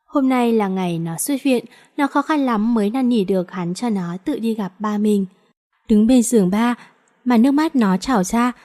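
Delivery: ordinary delivery, nothing marked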